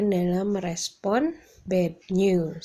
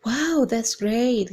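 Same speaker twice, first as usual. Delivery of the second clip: slow, loud, bright-eyed praise; excited wow; 4 semitones higher, 7 dB louder, much slower than usual. Shown as a demonstration. Teacher modelling delight at good news. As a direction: stretch it out with delight. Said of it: "you can say".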